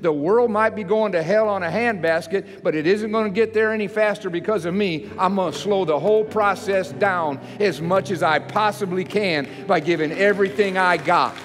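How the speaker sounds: fired-up pulpit declaration that keeps climbing; full-force preaching. Acted. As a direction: preach it hard, building to a defiant cry.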